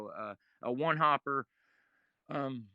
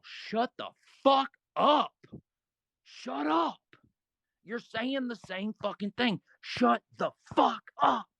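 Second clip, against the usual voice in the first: high, slow, drawn-out shout; telling two bickering players to shut up, shouting; fed-up yell; 7 semitones higher, slower than usual. Retold or imitated. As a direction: explode at both of them. Yelling at two arguing guys.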